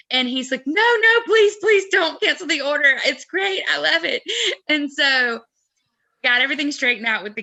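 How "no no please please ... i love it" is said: high, loud imitation of frantic begging; imitated panic, pitched way up; mock panicked pleading. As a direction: plead urgently, hammed up for the retelling. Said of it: "now Tom is panicking".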